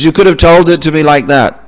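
protesting the lesson; exasperated reply. Acted. Neighbour repeats the advice.

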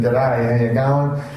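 put-on Australian greeting; Australian accent. Newcomer showing off his new identity, Australian accent. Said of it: "start to speak with Australian accent".

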